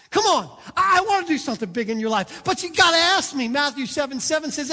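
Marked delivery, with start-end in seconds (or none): 0.1-3.5 loud, driving sermon delivery; full-force preaching. Acted. Preacher pleading for the congregation to ask. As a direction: preach it out to the crowd.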